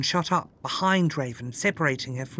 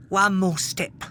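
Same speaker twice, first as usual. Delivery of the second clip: sneering; 2 semitones higher than usual. A narrator's reading. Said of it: "he sneered".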